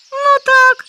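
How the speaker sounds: very high loud gleeful yes; happy to confirm; with joy. Acted. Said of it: "з радістю відповів заєць". (з радістю, дуже високо й гучно)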